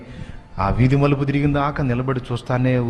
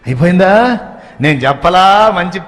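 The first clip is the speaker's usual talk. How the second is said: higher, much louder gloating questions; eager loud uncle; 8 semitones higher, 8 dB louder than usual. Acted. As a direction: crow over the poison working. Uncle gleefully asks about poison. (విషం పనిచేసిందని గట్టిగా అడగడం)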